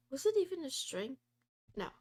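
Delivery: ordinary talk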